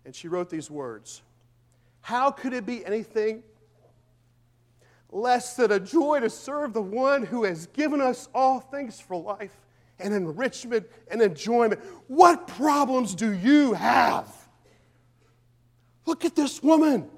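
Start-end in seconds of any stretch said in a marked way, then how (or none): 2.0-11.8 louder and higher, building to a peak; fervent preaching read. Acted. Reads her written words fervently. 12.1-14.3 slow, loud, high challenging shout; preacher's shout. Acted. Peak of the sermon.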